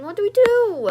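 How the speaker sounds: loud, high panicked cry; panicked shout. Acted. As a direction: blurt it out in alarm. Panicked kids at five am.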